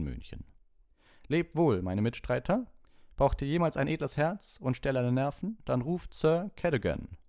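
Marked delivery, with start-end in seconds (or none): 1.3-7.2 high, loud grand farewell; knight's farewell. Acted. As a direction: bid farewell like a gallant knight. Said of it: "rief der Ritter".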